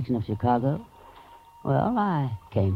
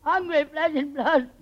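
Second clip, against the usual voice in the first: high crying voice, slowed, words cracking; breaking into sobs; slower than usual. Acted. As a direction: let hunger break her into tears. Connie breaks down from hunger.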